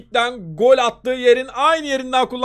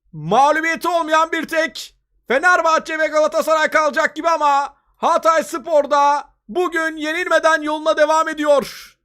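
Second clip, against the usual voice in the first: ordinary delivery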